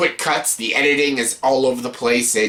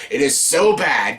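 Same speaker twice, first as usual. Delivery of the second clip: usual speaking voice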